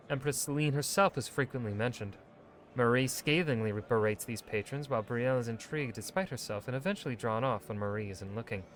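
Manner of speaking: steady storytelling read; measured narrator reading a codex entry; codex narration. Acted.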